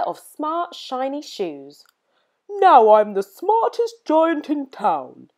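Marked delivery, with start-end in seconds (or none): 2.5-5.3 slow, proud booming giant voice. A narrator's reading. Giant boasting to himself, proud and slow. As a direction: puff up like the proudest giant in town. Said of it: "he said proudly".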